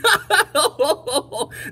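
loud, high ha-ha into ho-ho; elephant guffaw. Acted. Elephant shakes with laughter, loud acted guffaw. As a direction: belt out a hammed-up belly laugh. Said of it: "He ho-hoed and ha-ha'd".

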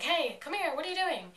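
high, loud calling out to the Doctors; mock scream. Clara calling out to the old Doctors, a mock scream. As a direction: yell to get their attention. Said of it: "with them and scream".

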